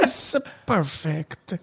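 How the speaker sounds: mock Italian waiter. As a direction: ham up an Italian waiter with a jokey Italian accent. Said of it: "I had a similar experience".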